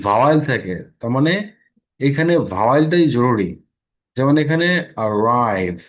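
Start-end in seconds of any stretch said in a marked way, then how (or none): 5.1-5.8 slow "arrive" with the r sounded before the vowel; slow model word. Shown as a demonstration. Teacher sounding out "arrive" slowly for the class. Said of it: "আর এর উচ্চারণ হচ্ছে".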